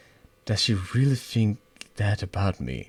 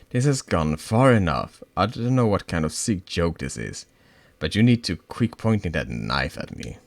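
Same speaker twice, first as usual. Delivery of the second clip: louder firm warning; angry; 5 dB louder than usual. Acted. Threatened girl orders friend to drop the knife, angry. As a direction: put your foot down hard.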